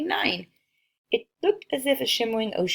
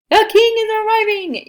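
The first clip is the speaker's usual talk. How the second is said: yelled; 12 semitones higher, 8 dB louder than usual. A narrator's reading. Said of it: "yelled Circumference".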